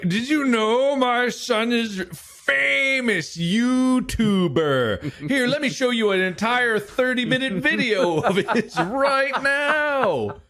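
high, drawn-out gushing mother; bragging mom. Retold or imitated. Mom showing strangers her son's videos, high and gushing. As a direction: mimic an over-proud mom, stretching the boast. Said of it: "my mom is still like that".

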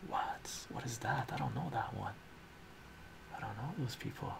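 soft puzzled whisper; hushed bemused aside. Real, not acted. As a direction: murmur it in quiet confusion.

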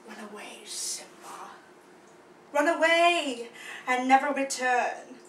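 low, hushed menace building to full voice; deep stage villain; sinister villain. Acted. Acted villain banishes the cub.

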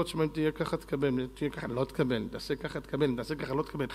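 low, quiet, measured-out conditions; father laying down rules. Acted. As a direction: set the terms softly, like a father. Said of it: "שאבא אומר לו".

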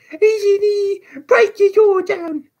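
put-on very high, loud boy's scream; piercing, desperate child's cry; air-rending shriek. A narrator's reading. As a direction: shriek to be heard outside the house. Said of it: "Shrieked the boy in a voice that rent the air".

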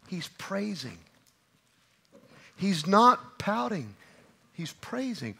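a soft, hushed "He's praising."; praising said softly. Shown as a demonstration. Preacher contrasting pouting with praising, hushed. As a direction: drop to a near hush on "praising". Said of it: "contrast pouting vs praising".

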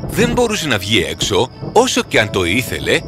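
ordinary delivery

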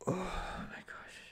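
slow, breathy whispered awe; awed whisper. Real, not acted. Developer takes in the release, whispering in awe. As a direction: breathe it out in wonder. Overwhelmed by the news.

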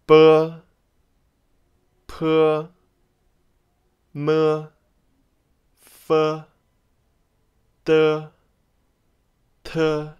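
B P M F D T N L as drawn-out syllables on a level tone; read-after-me pronunciation. Shown as a demonstration. Teacher has learners read after her, drawn-out demo. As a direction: model each Pinyin initial, leaving room to repeat. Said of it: "you may read after me".

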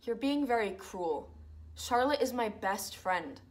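soft, hurt and close to tears; wounded plea. Acted. To her roommates, a hurt, subdued protest. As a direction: hold back tears while defending yourself.

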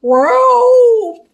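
very high, loud doggy howl; yelping cartoon dog bark; Scooby-Doo. A narrator's reading. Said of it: "Scooby barked".

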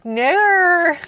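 loud, high mock-Australian; Australian accent. Correcting a co-player in a loud mock Australian accent. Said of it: "this is the Australian accent".

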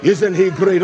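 ordinary delivery

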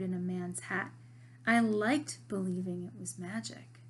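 lively read-aloud of a novel chapter; story reading. A narrator's reading. Teacher reading a novel chapter to her students, animated. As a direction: read the chapter to the class with voices.

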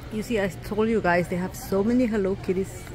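ordinary talk

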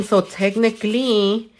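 ordinary talk

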